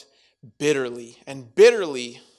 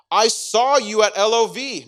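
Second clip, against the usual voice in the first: higher, louder accusing call-out; mock confrontation, higher and louder; loud accusation; 7 semitones higher, 9 dB louder than usual. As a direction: nail him with it, loud and sure. Acted accuser confronting Peter.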